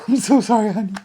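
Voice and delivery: usual speaking voice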